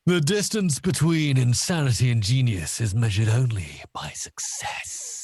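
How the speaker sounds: grand villain declaration fading to a long whisper; theatrical evil-genius read; Bond villain. Acted. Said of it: "as if he was a Bond villain".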